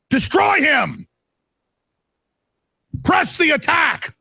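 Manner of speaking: high, slow, shouted battle cry; furious shout. Real, not acted. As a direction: yell it at the top of your voice. Radio host urging all-out attack.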